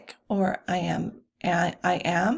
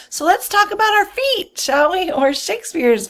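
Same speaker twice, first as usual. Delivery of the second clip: playful; 9 semitones higher, 7 dB louder than usual. Real, not acted. Playful teacher invitation.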